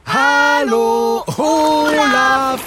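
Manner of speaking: sehr laut und hoch gerufen; laut rufen. Pretend. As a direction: ruf mit aller Kraft zum Wal. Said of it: "winken und rufen". (laut und hoch rufend)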